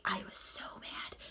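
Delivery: slow unvoiced whisper, still upset; angry whisper. Real, not acted. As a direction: hiss it under breath, still mad. Confessing anger at Akito.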